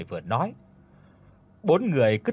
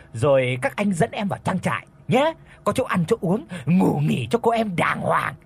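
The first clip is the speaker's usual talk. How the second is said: higher-pitched, mocking villain sweet-talk; leering teasing; 4 semitones higher than usual. A narrator's reading. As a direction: toy with the victim in a raised, taunting voice. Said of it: "bắt đầu cợt nhả nói".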